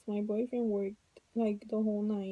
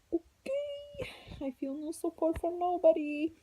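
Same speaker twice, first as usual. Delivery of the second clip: mock exasperation; 8 semitones higher than usual. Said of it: "And I'm like".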